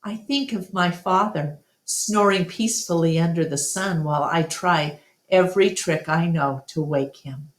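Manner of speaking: ordinary delivery, nothing marked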